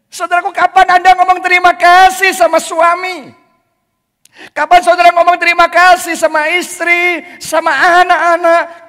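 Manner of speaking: loud, high preaching call; preaching at full force. Acted. Preacher pressing the congregation, loud and high. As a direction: press the question hard on the crowd.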